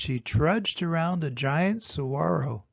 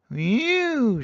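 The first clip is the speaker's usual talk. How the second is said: small mew; 7 semitones higher, 6 dB louder than usual. A narrator's reading. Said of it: "she said".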